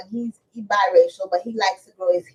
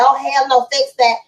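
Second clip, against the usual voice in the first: yelling out the window, a bit louder; retold yell; 5 semitones higher, 5 dB louder than usual. Mother calling out to her son about his sagging pants, loud. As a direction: call out sharply at the kids. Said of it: "I yelled out the window".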